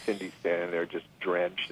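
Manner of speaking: ordinary delivery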